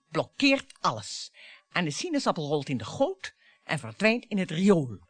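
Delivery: normal talking voice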